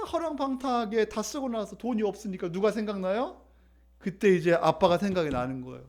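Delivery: ordinary talk, nothing marked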